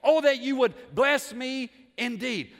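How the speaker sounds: loud, high, slow and weighty; full-force preaching. Real, not acted. Preacher answers the charge of selfishness, proclaiming loudly. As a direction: proclaim it, leaning on each key word.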